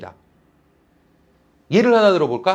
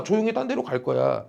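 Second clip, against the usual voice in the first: quiet; 7 semitones lower, 6 dB quieter than usual. Shown as a demonstration. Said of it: "그냥 조용히 딴 데로 갈 거야".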